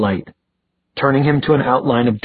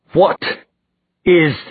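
higher, louder, through clenched teeth; angry demand; 7 semitones higher, 3 dB louder, slower than usual. A narrator's reading. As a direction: snarl it through gritted teeth. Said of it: "he demanded through clenched teeth".

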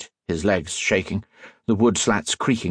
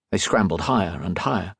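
ordinary delivery

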